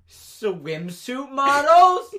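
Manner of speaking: high, loud, drawn-out questioning read; incredulous reading. Retold or imitated. Girl reading out a ridiculous party card, incredulous. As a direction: stretch each word out in rising disbelief.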